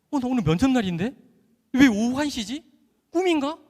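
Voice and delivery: high, loud alarmed disbelief; startled, high-pitched confusion; panicked surprise. Retold or imitated. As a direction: blurt it out, suddenly realizing you overslept. Waking up late on interview day.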